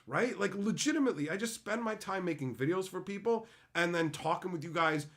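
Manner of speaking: normal talking voice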